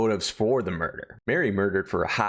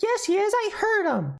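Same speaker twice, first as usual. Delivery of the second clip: put-on much higher, insistent little girl. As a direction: protest like a stubborn small child.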